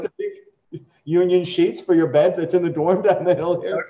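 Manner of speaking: mock-generous dad, a touch put on; sarcastic parent. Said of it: "But I'm like".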